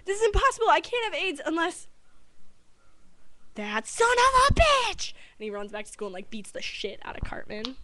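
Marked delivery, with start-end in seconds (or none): 0.0-1.9 loud, high cartoon protest; hammed-up disbelief in a raised voice; protesting boy. Boy working out how he was infected, high agitated imitation. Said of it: "And then he's like". 3.5-5.1 slow, loud, high furious yell; mock rage. Boy realizing who infected him, slow loud mock rage. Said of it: "And then he's like".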